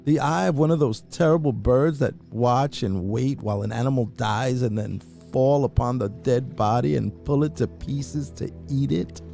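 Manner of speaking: ordinary delivery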